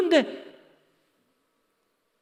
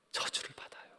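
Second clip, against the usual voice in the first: very soft, voiceless warning; grave whisper; 22 dB quieter than usual. Real, not acted. Preacher warning the congregation of a curse, whispering. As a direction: drop to a solemn whisper.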